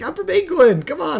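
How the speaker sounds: high goofy tourist voice; squeaky clueless Englishman; mock tourist voice.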